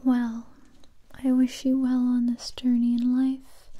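ordinary talk

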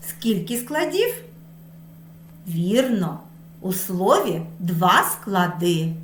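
usual speaking voice

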